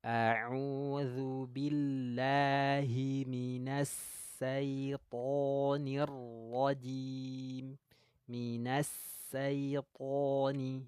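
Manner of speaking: very slow, syaitoni said with plain s not sh; wrong recitation. Shown as a demonstration. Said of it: "beda nggak kalau saya bacanya seperti ini".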